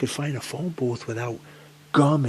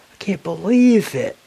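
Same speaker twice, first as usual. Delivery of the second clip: exasperated; 9 semitones higher, 9 dB louder than usual. Real, not acted. Gas station closed.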